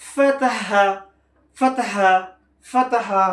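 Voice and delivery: সামিআ said high and loud, syllables joined; blended syllables. Shown as a demonstration. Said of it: "তিনটা একসাথে করে দিব".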